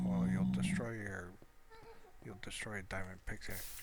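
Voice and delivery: ordinary delivery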